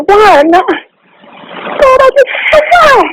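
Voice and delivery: high-pitched anguished wail; real overwhelmed grief; very upset. Real, not acted. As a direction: cry out to God for a reason, voice soaring. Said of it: "I am very upset".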